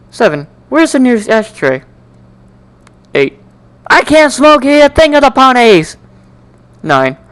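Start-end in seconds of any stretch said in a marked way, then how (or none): 3.9-6.0 fretful worry. Acted. Fretting inner thoughts.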